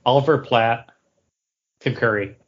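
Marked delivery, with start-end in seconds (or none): none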